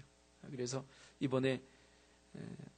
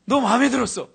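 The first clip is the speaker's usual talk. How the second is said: louder, higher senior's voice; senior boy; 8 semitones higher, 15 dB louder than usual. Retold or imitated. Tall senior telling the freshman he likes him, raised voice. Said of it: "뭐라고요?".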